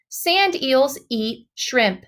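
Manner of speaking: ordinary delivery, nothing marked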